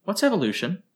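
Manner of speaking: put-on high, quick childlike chatter; lightly voiced eager child; curious girl rattling off answers; little girl.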